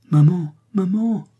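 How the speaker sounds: high, loud, drawn-out cry; anguished call. A narrator's reading. Lise calling to her fainting stepmother, in anguish. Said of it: "dit Lise avec angoisse".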